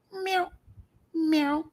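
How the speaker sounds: high, light cat meow; imitated meow. Shown as a demonstration. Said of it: "Mamu's meows are very light".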